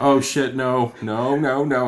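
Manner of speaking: hammed-up Batman horrified; mock Batman panic. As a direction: back away in hammed-up alarm. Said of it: "Batman voiced refusing".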